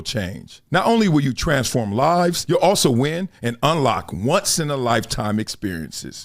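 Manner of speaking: steady sponsor-spot delivery; ad read. Acted. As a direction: read it like a polished ad. Reads a sponsor ad.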